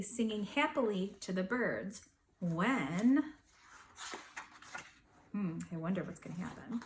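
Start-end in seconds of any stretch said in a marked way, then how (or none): 5.3-6.8 quick quiet tease; playful soft aside; teasing aside. Real, not acted. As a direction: whisper a hint of suspense. Aside to listeners.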